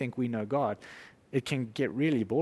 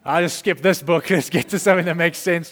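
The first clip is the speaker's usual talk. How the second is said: laughing dismissal; 3 semitones higher, 7 dB louder than usual. Retold or imitated. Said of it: "I used to read my Bible and go like".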